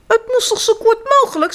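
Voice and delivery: fast, high innocent reply; quick high girl voice. A narrator's reading. Girl answers her shocked mother, quick and high. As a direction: rattle it off like a cheeky girl. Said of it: "zei Floddertje".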